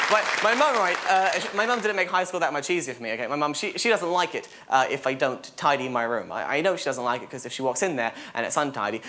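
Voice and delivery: normal talking voice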